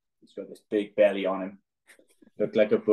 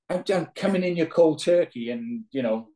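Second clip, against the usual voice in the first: mock pleading teammate; 7 semitones higher than usual. Teammate voiced in story.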